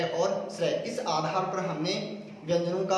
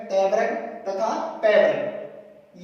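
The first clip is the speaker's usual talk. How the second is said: very slow and deliberate; slow summing-up; 3 dB louder, much slower than usual. Shown as a demonstration. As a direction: draw out the last items of the list. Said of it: "slow list ending".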